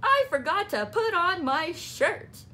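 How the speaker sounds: Froggy confessing to mother; sheepish Froggy. A narrator's reading. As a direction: admit it sheepishly. Froggy admits mistake.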